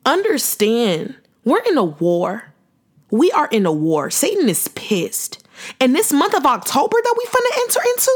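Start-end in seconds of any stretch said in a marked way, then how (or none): 5.8-8.1 fast and much higher, bursting with excitement; hyped. Real, not acted. To her listeners about the coming month, fast and hyped.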